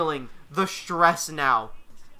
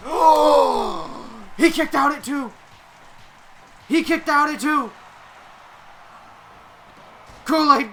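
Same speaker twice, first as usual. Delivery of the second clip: wrestling commentator; 4 semitones higher, 4 dB louder than usual. Acted. Play-by-play wrestling call.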